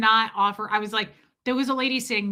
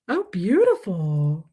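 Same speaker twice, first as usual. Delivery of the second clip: slow, lower gushing sigh; mock rapture; 4 semitones lower, slower than usual. Admiring her own holy tale, slow mock rapture. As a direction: swoon over it.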